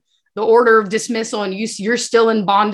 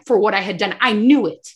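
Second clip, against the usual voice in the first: ordinary talk, nothing marked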